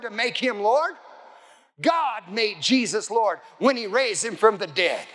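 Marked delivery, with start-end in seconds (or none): none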